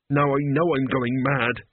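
usual speaking voice

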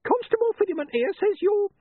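very high, screechy nagging wife; high-pitched henpecking holler; scolding wife. A narrator's reading. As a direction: call your husband down in a piercing high voice. Wife calls up to husband.

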